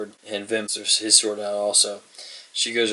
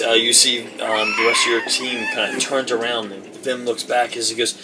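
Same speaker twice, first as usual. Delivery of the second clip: usual speaking voice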